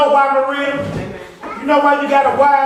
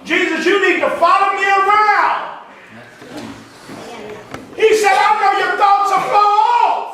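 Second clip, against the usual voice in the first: full-force preaching; 6 semitones higher than usual. Acted. Preacher chanting at the congregation, full force. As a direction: belt it to the congregation.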